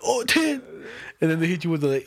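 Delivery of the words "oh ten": "Oh, ten." jumps high in disbelief; shocked. Retold or imitated. Retelling his shock at the tax bill, high surprise.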